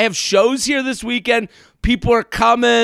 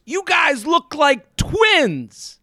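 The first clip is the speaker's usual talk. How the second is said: stranger's remark; 7 semitones higher, much slower than usual. Retold or imitated. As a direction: drawl it out like an amazed stranger.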